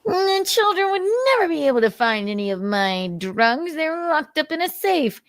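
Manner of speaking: high sing-song smug voice; high sarcastic whine; mocking smug parent. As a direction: parody the careless parent's excuse.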